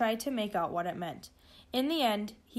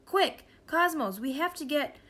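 high, pressing cry; urgent shout; 6 semitones higher, 3 dB louder than usual. A narrator's reading. George urges the computer to hurry, shouting. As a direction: call out urgently, pitched up. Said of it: "shouted George".

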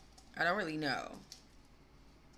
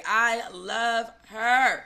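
adoring; 3 semitones higher, 6 dB louder, much slower than usual. Real, not acted. Gushing fan.

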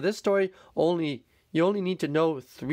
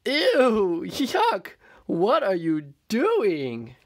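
disgusted mole rats; 5 semitones higher, much slower than usual. Acted. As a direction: squeal it in disgust. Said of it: "they said".

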